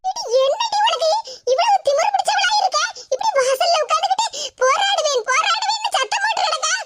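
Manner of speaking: high, indignant mother's voice; exasperated mother scolding her daughter. Acted. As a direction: tell off the cheeky daughter, high.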